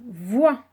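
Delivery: Voix said loud on its own; sounding out words. Shown as a demonstration. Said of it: "syllabe par syllabe".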